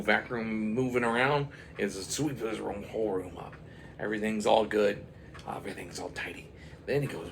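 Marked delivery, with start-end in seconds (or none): none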